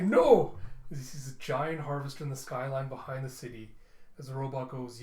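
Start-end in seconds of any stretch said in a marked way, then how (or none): 0.0-0.6 a much higher, much louder cry of horror; anguished shout. Acted. Ozris seeing the harvester, horrified shout. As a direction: scream it as the harvester appears. City about to be destroyed.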